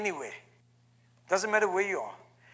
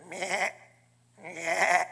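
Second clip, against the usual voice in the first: sheep bleat; 4 semitones lower, 5 dB quieter than usual. Acted. Ram bleating in story.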